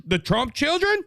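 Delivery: ordinary talk, nothing marked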